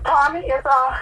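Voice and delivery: normal talking voice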